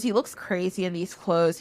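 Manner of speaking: ordinary delivery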